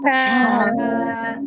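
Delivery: ہا with a puff of air out first; breathy ha. Shown as a demonstration. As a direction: push the air out, then say ہا. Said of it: "اپنے منہ کے آگے ہاتھ رکھیے".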